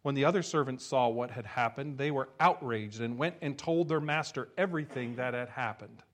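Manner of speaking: ordinary delivery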